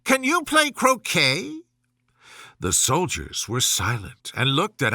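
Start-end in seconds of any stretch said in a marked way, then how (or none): 0.0-1.6 high royal shout; shouting Queen. Acted. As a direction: fling the question at Alice. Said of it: "shouted the Queen".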